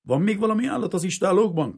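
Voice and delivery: loud, high-pitched demanding question; commanding. A narrator's reading. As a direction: question them like a sergeant in charge. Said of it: "kérdezte parancsoló hangon".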